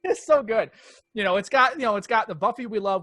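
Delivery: delighted. Real, not acted. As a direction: gush about it, still buzzing from laughing.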